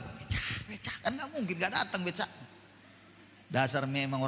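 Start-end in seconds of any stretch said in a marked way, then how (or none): 0.0-1.0 whispering for the pedicab; whispered call. Retold or imitated. Man mockingly whispers for a pedicab he cannot shout for. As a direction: call the becak under your breath. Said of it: "Nggak mungkin saya panggil".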